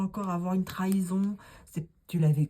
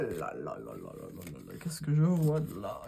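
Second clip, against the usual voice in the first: mock seer; 11 semitones lower than usual. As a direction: play the fortune teller peering in. Mock fortune teller gazing. (voyante de cinéma)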